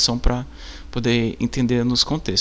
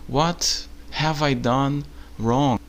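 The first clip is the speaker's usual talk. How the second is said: very slow, softer, careful English question; slow English example; 4 dB quieter, much slower than usual. Shown as a demonstration. Teacher modeling a question for tourists, very slow. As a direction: spell it out slowly for learners. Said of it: "em inglês, por exemplo".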